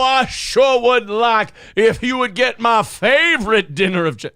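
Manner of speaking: high, loud, exaggerated mock-servant voice, pitched well up; Perry's caricature voice. Reenacting the Perry bit for the stream, high loud exaggerated caricature voice. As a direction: ham it up as an over-the-top fawning caricature, high and loud. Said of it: "I will play audio of Perry".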